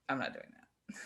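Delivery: low, soft private thought; quiet, low-pitched balking to oneself; shy refusal. Retold or imitated. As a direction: say it under your breath, recalling your reluctance. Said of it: "I'm a, a pretty shy and quiet person".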